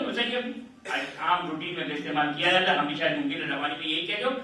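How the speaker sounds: ordinary talk, nothing marked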